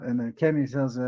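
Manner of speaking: ordinary talk, nothing marked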